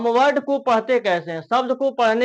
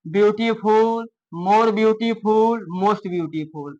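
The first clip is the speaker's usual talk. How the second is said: ordinary talk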